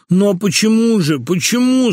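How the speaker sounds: high, passionate pleading; bovine passion. A narrator's reading. Abandoned husband asks his wife why, with bovine passion. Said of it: "сказал Лоханкин с коровьей страстностью".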